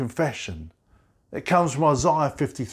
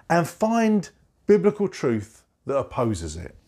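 ordinary talk, nothing marked

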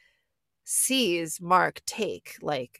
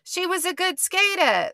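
put-on high Jersey accent, dropped r's; New Jersey mom; 12 semitones higher than usual. Imitating a mom praising her skater daughter, Jersey accent. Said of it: "they're in New Jersey".

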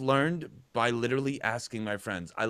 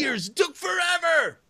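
loud, high shout of frustration; exasperated shout; 5 dB louder than usual. Real, not acted. Telling the co-host how long pinch harmonics took, shouted high.

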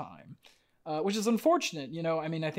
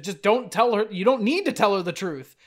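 loud, raised-pitch exasperation; exasperated rant; 6 semitones higher, 8 dB louder than usual. Real, not acted. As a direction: argue it hotly, voice high and loud. Heated rant about the character's choice.

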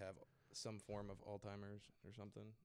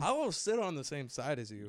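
loud, higher-pitched taunt; raised-voice defiance; bickering threat; 4 semitones higher, 12 dB louder than usual. Real, not acted. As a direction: threaten it loudly mid-squabble. Squabble over sides of table.

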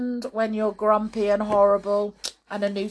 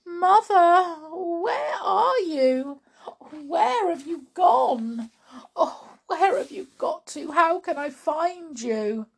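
high, drawn-out calling for a lost mother; anxious, bewildered high-pitched woman; puzzled, searching wail; 10 semitones higher than usual. Acted. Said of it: "with a puzzled look on her face".

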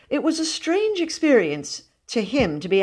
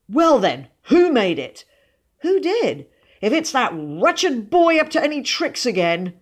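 raised, higher-pitched cross questioning; indignant father; 7 semitones higher, 4 dB louder than usual. A narrator's reading. Father suspecting his wretched boy of tricks, indignant. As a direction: demand it with mounting annoyance.

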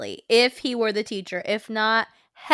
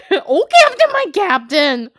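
higher, playful theatrical cry; dramatic quote; 6 semitones higher, 6 dB louder than usual. Pretend. Rehearsing the podcast's closing quote, dramatic. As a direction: try the line hammed-up and raised. Trying the dramatic line in character.

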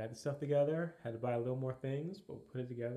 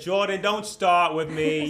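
loud mock warning; 5 semitones higher, 15 dB louder, slower than usual. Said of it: "I said".

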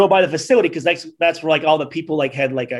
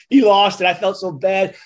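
ordinary delivery

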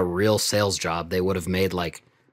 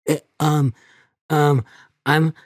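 put-on high, hesitant little kid; nervous kid voice; 8 semitones higher, 8 dB louder than usual. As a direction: stammer it out like a shy schoolkid. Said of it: "it's the kid selling it".

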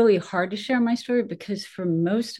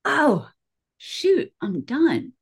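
mock gasp, much higher; a light retelling of pain and giving in; sudden realization; 10 semitones higher, 4 dB louder, much slower than usual.

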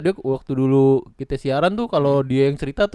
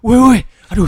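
scared shout; 7 semitones higher, 9 dB louder than usual. Acted. Acted ghost chase skit.

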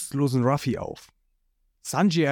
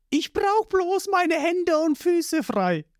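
put-on much higher, slow boyish voice; confident Ruffy; slower than usual. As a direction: sure of himself, unworried.

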